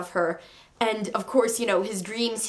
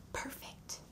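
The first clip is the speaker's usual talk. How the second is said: whispered; 21 dB quieter than usual. Real, not acted. Dreamy whisper about movie.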